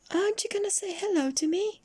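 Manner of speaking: tiny, very high questioning voice; little goblin voice. A narrator's reading. Goblin asking Mister Uppity for a hello, high squeak. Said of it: "asked the goblin".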